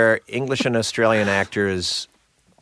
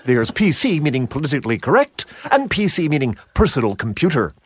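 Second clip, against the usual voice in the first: higher, clipped, precise ad read; clipped sitcom-style delivery, pitched up; David Hyde Pierce; 4 semitones higher than usual. Shown as a demonstration. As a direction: crisply enunciate the dry wordplay. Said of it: "sounded like David Hyde Pierce".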